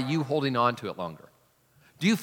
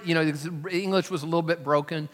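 ordinary talk

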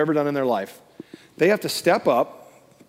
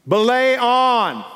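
slow, high, loud holler; proclaiming call; 7 semitones higher, 5 dB louder, much slower than usual. Acted. God answers the waiting climber, calling out. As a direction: stretch it out as a triumphant answer. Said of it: "God was saying".

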